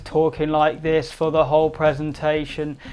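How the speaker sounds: flat, unvarying pitch all the way through; monotone. Shown as a demonstration. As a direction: demonstrate a dull, unengaging monotone. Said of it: "that's not gonna be very engaging".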